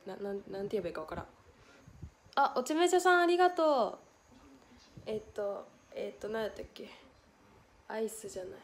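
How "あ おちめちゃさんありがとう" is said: excited thanks. Real, not acted.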